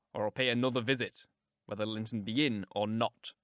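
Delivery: low, insistent man's voice; brooding man. Acted. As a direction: warn her in a low, firm voice.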